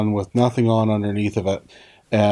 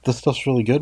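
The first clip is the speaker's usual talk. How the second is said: incredulous echo; faster than usual. Retold or imitated. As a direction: throw it back as a doubting question. Questions the doctor's assurance back.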